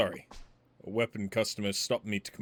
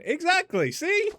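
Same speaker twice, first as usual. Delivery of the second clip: much higher, louder mock squeal; squeaky exclamation; 7 dB louder, slower than usual. Streamer proving the accent point, high squeal. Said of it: "Not the same without the accent".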